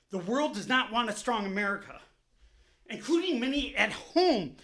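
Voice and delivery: ordinary talk, nothing marked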